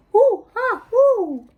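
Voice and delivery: high, loud monkey hoots; excited jungle monkey whoops; monkey calls. Acted. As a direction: hoot like a playful monkey. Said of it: "Ten little monkeys say".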